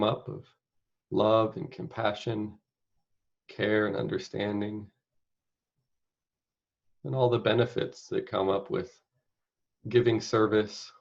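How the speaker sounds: unhurried meditation guide, long pauses between phrases; guided meditation. Acted. Meditation leader inviting listeners to picture caregiving, spacious guided-meditation delivery. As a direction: guide them gently, phrase by phrase, leaving space.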